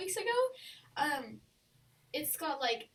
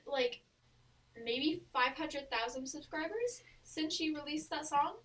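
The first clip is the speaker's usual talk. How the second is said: ordinary delivery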